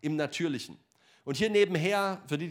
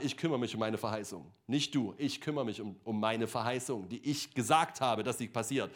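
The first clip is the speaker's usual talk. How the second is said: lower, firm divine voice; a hint of a calm, lower God voice; voicing God; 6 semitones lower, 4 dB quieter than usual. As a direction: speak as God, settled and sure. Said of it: "und sagt".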